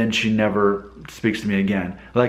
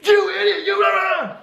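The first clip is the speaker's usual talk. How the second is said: very high, garbled mock shouting; mock road rage. Driver yells at whoever cut him off, mock road-rage shout. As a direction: rant like a driver losing his temper, hammed-up.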